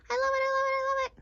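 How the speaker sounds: high, rapid-fire gushing; gleeful. Acted. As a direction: chant it with giddy delight. Puppet gushing over snack and books.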